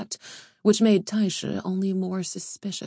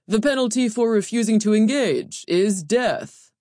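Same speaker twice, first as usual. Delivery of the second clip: loud, high proclamation with sweeping swells; grand, forceful address to a crowd; booming overseer; 4 semitones higher, 6 dB louder than usual. A narrator's reading. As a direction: announce the rules like an arena official. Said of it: "the overseer continued".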